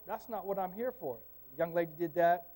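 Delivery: ordinary talk, nothing marked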